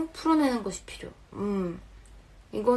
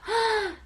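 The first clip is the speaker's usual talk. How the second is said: high surprised gasp; sharp gasp; 12 semitones higher than usual. Real, not acted. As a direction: gasp in amazement. Reacts to a fitting description.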